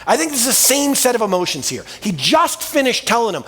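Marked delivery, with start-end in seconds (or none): none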